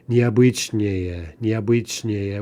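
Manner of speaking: slow "Необычнее", stressed on бы, with unstressed -ее; stress stays on the stem. Shown as a demonstration. Teacher shows stress staying put, leaning on бы in "необычнее". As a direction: hit бы in "необычнее" and let the ending go. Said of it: "the stress to stay put".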